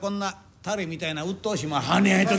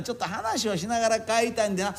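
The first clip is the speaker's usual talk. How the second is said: ordinary talk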